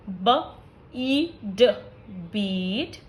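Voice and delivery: slow blending with pauses between sounds; sounding out beat. Shown as a demonstration. Teacher giving long vowel examples, sound by sound. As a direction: say ब, ई, ड separately, then blend them into बीट with a long ई. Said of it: "For example".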